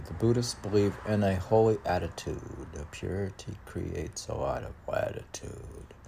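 rhythmic rhyming recital, lightly voiced; poem reading. Poet reading his rhyming verse to the host.

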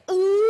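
loud, high teasing ooh; hammed-up schoolkid ooh; scandalized kids' "ooh". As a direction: imitate kids reacting to a kiss. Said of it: "Anytime that we kissed".

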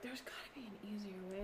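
under her breath, unvoiced; a muttered hunch; whispered thought. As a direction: whisper it to yourself, puzzling. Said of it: "And I was like".

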